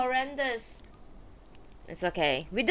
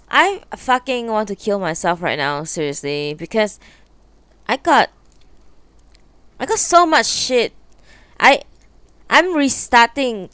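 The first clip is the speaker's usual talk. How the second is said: loud, fed-up venting; exasperated rant; 2 semitones lower, 14 dB louder than usual. Real, not acted. Streamer losing settings, ranting loudly in frustration. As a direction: blow off steam, loud and fed up. Streamer overwhelmed by setup trouble.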